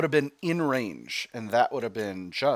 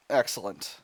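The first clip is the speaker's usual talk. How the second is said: usual speaking voice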